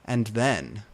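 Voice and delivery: usual speaking voice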